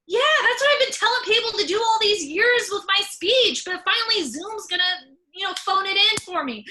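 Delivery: loud, high gleeful outburst; excited retort. Retold or imitated. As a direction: burst out like you finally won the argument. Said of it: "I was like".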